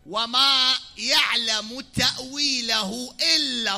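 وما and إلا proclaimed loud and high, slow; emphatic Quran recitation. Shown as a demonstration. Preacher showing negation and exception in a verse, slow loud proclaiming. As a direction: declaim the verse, leaning hard on وما and إلا. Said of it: "ما نافية واستثناء".

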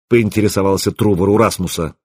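plain reading voice

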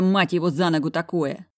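ordinary delivery, nothing marked

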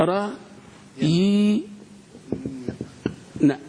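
"را-، ي-، ن" separately, with a long held "yii"; sounded out. Shown as a demonstration. Teacher has class repeat, one syllable at a time. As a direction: break "را-، ي-، ن" into syllables with long pauses. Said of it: "قول".

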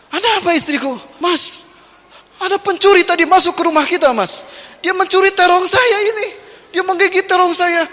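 high, upset complaining wife; a mock-indignant wife voice. Wife complains to husband about the thief, high and indignant.

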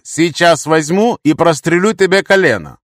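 loud, high threat; threatening warning. A narrator's reading. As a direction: warn him forcefully, dragging the words. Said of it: "честно предупредил я".

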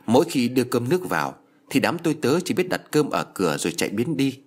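ordinary delivery, nothing marked

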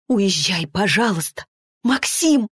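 usual speaking voice

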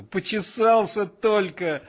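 high, drawn-out exclamation; plaintive protest. A narrator's reading. Lieutenant explaining himself amid everyone's laughter, protesting. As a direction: stretch it out high in helpless protest.

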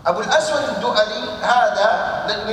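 usual speaking voice

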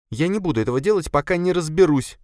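high, quick female voice, firmly refusing; sharp-edged refusal in a high woman's voice; slightly sharp. A narrator's reading. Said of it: "ответила она чуть резче обычного".

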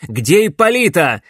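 loud, sharp bark; barking demand. A narrator's reading. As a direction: bark the question. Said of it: "рявкнул Грановский".

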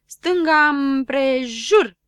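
loud, slow drawn-out command; drill command. Acted. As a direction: give the about-turn like a sergeant. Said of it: "comandă Șurubel". (comandă tare și rar)

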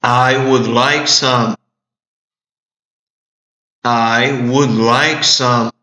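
slow, deliberate repetition; slow model. Shown as a demonstration. Teacher repeating the phrase slowly for students. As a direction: say it slowly so they can copy. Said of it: "teacher repeats the model".

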